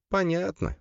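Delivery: high-pitched sister character voice; sister's drawl. A narrator's reading. Sister giving up on a pointless conversation, high drawl. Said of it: "протянула сестра".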